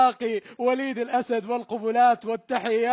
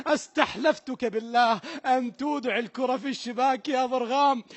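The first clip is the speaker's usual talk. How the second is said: ordinary talk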